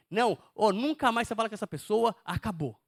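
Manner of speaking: loud, insistent order dropping on the last word; forceful urging. Acted. The enemy urging someone never to speak to that person, loud and insistent. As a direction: press it hard like a tempter.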